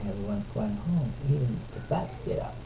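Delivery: soft, hushed bedtime-story voice; quiet picture-book reading to a little one. Real, not acted. Reads picture book to toddler.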